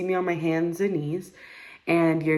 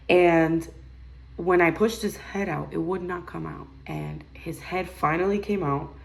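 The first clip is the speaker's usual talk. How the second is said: usual speaking voice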